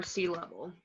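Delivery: normal talking voice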